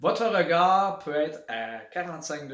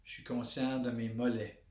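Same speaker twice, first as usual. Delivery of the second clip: guided body scan; 3 semitones lower, 6 dB quieter than usual. Shown as a demonstration. Instructor leading listeners through a body awareness exercise, calm meditation voice. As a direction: guide them inward quietly, one body part at a time.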